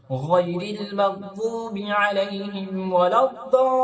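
slow, ওয়ালাদ-দাল্লিন with a long drawn madd. Shown as a demonstration. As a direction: stretch দাল্লিন fully. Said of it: "এটা মাদ্দে লাজিম". (মাদ্দে লাজিম টেনে)

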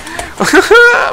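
loud high laugh; burst of laughter. Real, not acted. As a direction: laugh out at how badly it went. Laughing after a bad death.

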